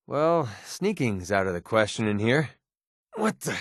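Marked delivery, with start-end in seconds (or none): 3.1-3.6 startled. Acted. Startled, cannot see.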